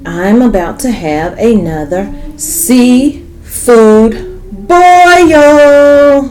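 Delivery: drawn-out, loud, high "boil"; slow, stretched excited announcement. Real, not acted.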